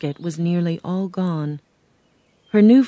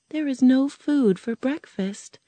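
put-on higher, girlish Dorothy voice; a worried little girl asking, pitched up; young girl Dorothy; 7 semitones higher than usual. Dorothy asking the Tin Man, girlish and higher.

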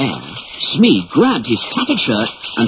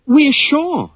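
much higher, small nervous reply; frightened boys. Acted. Boys answering the stern chief, a little frightened. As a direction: answer quickly, a bit scared. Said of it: "a little frightened".

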